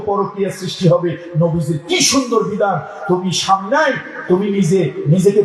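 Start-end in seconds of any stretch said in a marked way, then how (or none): none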